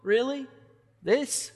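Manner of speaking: can't believe the good news; overjoyed Israelite reeling in disbelief at God; stunned disbelief. Retold or imitated. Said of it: "reeling a little bit in disbelief".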